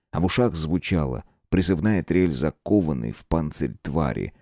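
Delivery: usual speaking voice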